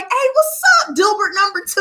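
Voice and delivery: very high, bubbly hello; high sing-song greeting to a butterfly; excited greeting. Retold or imitated. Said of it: "I was like".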